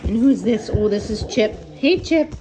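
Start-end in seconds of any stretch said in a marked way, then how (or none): 1.7-2.3 high, loud cooing at a puppy; sing-song hello to a pup; puppy talk. Real, not acted. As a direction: greet the puppy in a bright doggy voice. Greeting puppy in baby talk.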